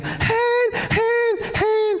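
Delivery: put-on very high, piercing little-kid cries; imitated child's screaming. Father imitating his child in the dark tunnel, high screaming. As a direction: shriek each word separately, imitating a scared child. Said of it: "dia teriak-teriak".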